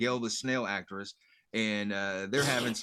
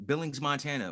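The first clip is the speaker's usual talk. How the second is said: ordinary talk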